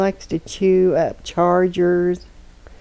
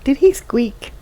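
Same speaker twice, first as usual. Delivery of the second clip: higher, louder quoted question; a touch of a friend's surprised voice; quoting a friend; 7 semitones higher, 6 dB louder than usual. Retold or imitated. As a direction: mimic a friend asking, higher. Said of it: "as one of my friends says".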